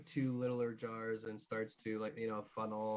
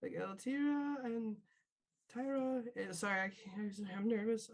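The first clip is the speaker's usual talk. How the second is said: higher, halting, stammering voice; nervous Mary; 8 semitones higher than usual. Acted. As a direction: stumble through it like a scared kid. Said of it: "Mary kinda nervous goes".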